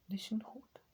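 breathy whispered repeat. Acted. Gamer whispering to himself while hiding.

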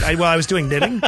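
laughing through the words; amused, half-laughing confession. Real, not acted. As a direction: giggle while you admit it. Joking about free time.

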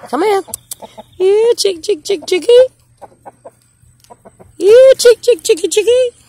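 high sing-song chick call; high, loud call to the hens; calling chickens. Real, not acted.

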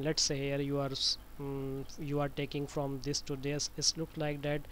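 ordinary delivery

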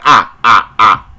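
put-on voice mocking a famous singer; mocking imitation. Telling viewers the famous man mocks God, in a mocking put-on voice. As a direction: ape him with exaggerated ahs. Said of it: "He's like".

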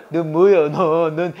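amused, laughing through the question; can't hold back a chuckle; low, giggly delivery. Real, not acted.

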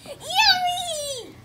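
high, loud squeal of delight with a laugh; delighted yummy. Acted. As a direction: squeal with joy at the food. Max delighted by dinner.

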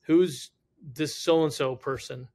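loud, slow call-out to the room; stern demand. Retold or imitated. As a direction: imitate serious guys demanding an answer. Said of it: "three really serious guys walk in".

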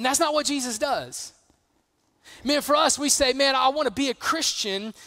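loud, high, driving sermon voice; full-force preaching. Acted. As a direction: preach it hard to the room.

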